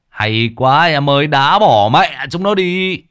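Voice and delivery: high, drawn-out cheer; excited cheering. A narrator's reading. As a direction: cheer loudly for your team, stretching the words. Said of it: "Tiến vui mừng đến lạc cả giọng hò hét". (hò hét vui mừng)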